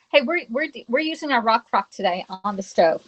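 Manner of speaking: normal talking voice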